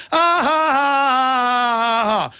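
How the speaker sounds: mock laughter. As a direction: mimic their scornful laugh. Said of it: "It's so funny".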